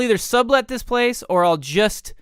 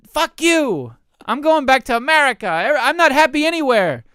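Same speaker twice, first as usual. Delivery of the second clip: mock outburst; 7 semitones higher, 5 dB louder than usual. Fed up, storming back home.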